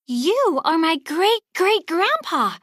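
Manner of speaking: put-on boy stunned by the truth; amazed realization. A narrator's reading. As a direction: let the discovery dawn in wonder. Miguel realizes Hector's identity.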